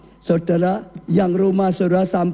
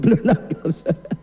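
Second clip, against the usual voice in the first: laughing; 4 semitones lower, faster than usual. Real, not acted. Preacher teases slow congregation.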